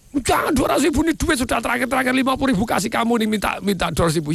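high, indignant haggling; acted anger. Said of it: "marah juga orangnya gitu karena dipaksa". (pura-pura marah menawar, nada tinggi)

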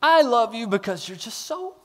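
high, soft doting voice; hammed-up sweet-talk to a cute sheep; mock adoring God to sheep. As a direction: coo it like doting on a fluffy pet. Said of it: "like saying".

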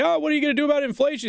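high, fast mocking question; mock reporter. Host imitating a reporter's question, fast high mock. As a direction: rattle it off high and quick. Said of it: "He's like".

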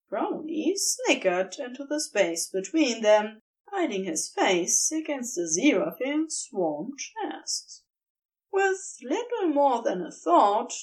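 ordinary delivery, nothing marked

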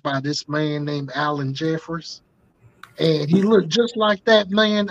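lightly put-on Southern dad accent, loose and folksy; a Southern father rambling on a voicemail. Voicemail to Tamara as her Southern dad, light Southern accent. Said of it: "it would be in that accent".

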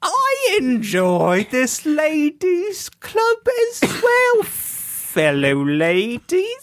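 slow, high mock English lady; subdued English lady. One club lady to her fellow ladies, slow high English accent. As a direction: put on a drawn-out, high-pitched English ladylike accent. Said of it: "subdued English lady".

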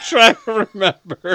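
can't get it out for laughing. Real, not acted. Cohost laughing while trying to remember the topic.

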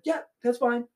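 high-pitched imitation of an unbothered parent; mock breezy approval. Parent excusing a pushy kid, mock breezy.

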